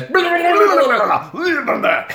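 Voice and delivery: high, loud babbling shriek; under-attack warble. Retold or imitated. Showing how Chucky sounds attacked, shrieked babble. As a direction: warble frantic gibberish like a doll under attack. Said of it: "when he's being attacked, he goes".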